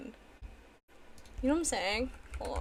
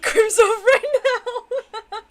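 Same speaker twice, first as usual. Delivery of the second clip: laughing; 9 semitones higher, 9 dB louder, much slower than usual. Real, not acted. Joking while laughing.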